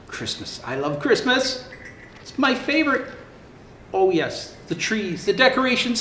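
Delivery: lively storybook narration; reading to children. Acted. Santa reading a zoo story to children, lively. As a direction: tell it brightly for little ones. Reads Fiona story aloud.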